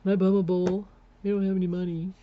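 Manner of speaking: a lightly voiced lazy husband cadging; mock husband begging. Husband begging his wife for a bowl, lightly mocking.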